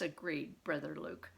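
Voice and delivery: ordinary delivery, nothing marked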